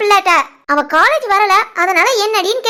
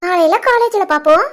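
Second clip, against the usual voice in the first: ordinary talk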